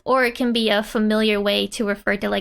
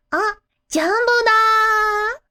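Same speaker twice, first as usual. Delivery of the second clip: put-on high, slow, surprised child voice; surprised Yotsuba; 10 semitones higher, 4 dB louder, slower than usual. Yotsuba spotting Jumbo, voiced high and surprised. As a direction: gasp out the name in childlike surprise. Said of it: "So she's just surprised".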